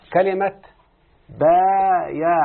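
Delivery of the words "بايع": بايع stretched very slowly, rising at the end; drawn-out word. Shown as a demonstration. Teacher stretching بايع slowly to show its pattern. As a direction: draw out بايع slowly for the student. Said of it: "على وزن إيه يا شيخنا".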